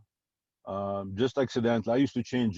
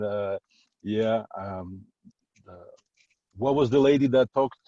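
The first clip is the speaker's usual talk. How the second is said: ordinary talk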